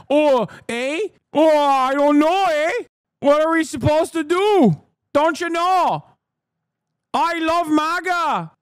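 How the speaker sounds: high, hammed-up Canadian accent with drawn-out eh; mocking a Canadian, slow and sing-song high. Mocking Canadian larpers in a silly Canadian accent. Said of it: "they're like".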